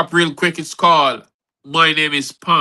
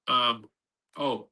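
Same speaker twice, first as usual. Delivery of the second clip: normal talking voice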